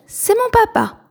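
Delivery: "c'est mon papa" jumped up very high, proud; proud, sky-high. A narrator's reading. Plume tells the cabin boy about his father, proud.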